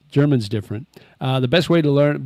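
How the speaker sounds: ordinary talk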